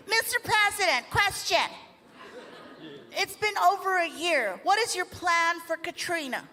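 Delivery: high, far press reporter calling out; high-pitched mock reporter. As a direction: call out like a reporter at the podium.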